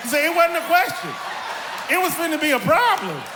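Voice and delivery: high, loud laughing punchline. Real, not acted. Telling the interviewer about mama, laughing loudly. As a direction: crack up as you say it.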